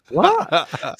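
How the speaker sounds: hammed-up high "What?"; incredulous minister, high. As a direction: squeak "What?" in amazed disbelief. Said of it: "And he's going".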